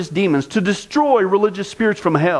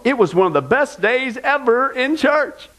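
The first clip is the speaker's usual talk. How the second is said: lightly mocking, slow and high-pitched rave; a hint of a thrilled churchgoer gushing; 8 semitones higher than usual. Quoting a churchgoer about Porn Sunday, mock gushing. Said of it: "said, quote".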